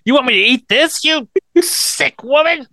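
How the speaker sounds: a hammed-up shocked squeal; outraged principal. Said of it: "It's like".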